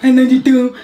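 loud mimicry of the botched reply; mocking imitation. Partner mimics the slurred love reply, mocking. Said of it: "Stop playing with me".

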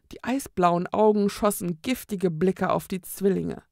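usual speaking voice

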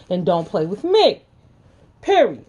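real furious warning to her critics; angry warning. Real, not acted. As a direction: slam the last word down like a door.

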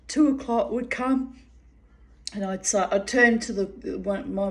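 normal talking voice

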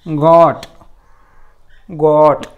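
गॉट loud, with open "aw", repeated after a pause; short O modelled. Shown as a demonstration. Teacher modelling the word for students, गॉट loud and deliberate. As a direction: punch out गॉट with a long open O. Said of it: "असा उच्चार करतो मित्रांनो".

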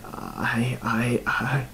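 soft, breathy, halting stammer. A narrator's reading. Killer unable to answer the dead man, soft stammer. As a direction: falter on the same word, barely voiced. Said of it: "furrowed his brow and stammered".

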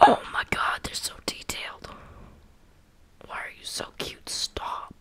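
gasp, then whisper in awe; barely voiced, overwhelmed by cuteness; hushed gushing. Real, not acted. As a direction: melt over something cute in a breathy whisper. Gushing over cute, detailed cats.

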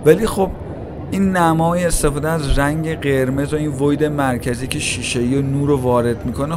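ordinary talk, nothing marked

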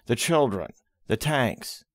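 usual speaking voice

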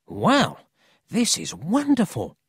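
delighted, swooping up high; thrilled boy. A narrator's reading. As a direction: marvel at the adventure. Paul thrilled by the story.

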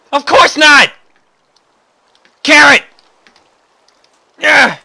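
high loud shouts; loud exclaiming. Real, not acted. Gamer finding his carrot, loud shouted excitement. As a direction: yell the words out.

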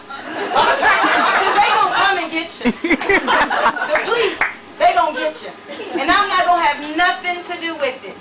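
high, loud laughing holler; mock her man through laughter. A narrator's reading. Woman laughing at her man's proposal, hollering. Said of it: "She started hollering and laughing".

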